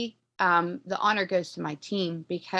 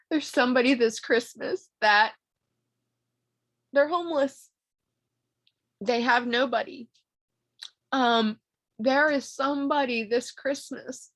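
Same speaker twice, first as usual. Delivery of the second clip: emotional; 2 semitones higher than usual. Real, not acted. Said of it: "I'm getting emotional".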